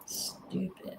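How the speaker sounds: breathy whisper under the breath. Real, not acted. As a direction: whisper the insult to yourself. Muttered insult repeated plainly.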